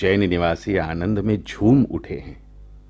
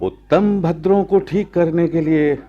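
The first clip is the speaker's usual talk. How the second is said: much higher, louder, scoffing; boastful scoff; 10 semitones higher, 6 dB louder than usual. Acted. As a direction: scoff as if it's nothing. Boastful laughing defiance.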